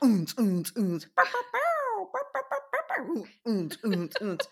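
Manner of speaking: beatboxed club bass thumps with high bow-bow squeaks; imitated techno beat. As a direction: mouth the thumping dance music.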